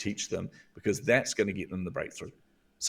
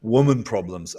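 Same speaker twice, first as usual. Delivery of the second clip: slow, louder, scornful drawl of a sneering boss; mock sneering boss; 3 semitones higher, 8 dB louder, slower than usual. Boss dismissing a grieving employee, slow sneering scorn. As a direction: scoff it out like a condescending boss brushing her off. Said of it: "He goes".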